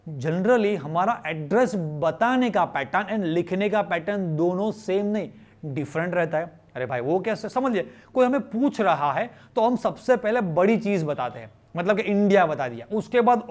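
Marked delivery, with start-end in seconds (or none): none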